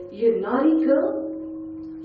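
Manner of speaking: naughty drawn out slowly with a rise; scolding nurse. Retold or imitated. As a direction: chide her like a disappointed nurse. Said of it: "She goes".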